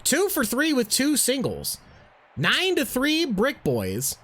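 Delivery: baseball announcer play-by-play. Acted. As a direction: call the game like a broadcaster. Plays announcer in game.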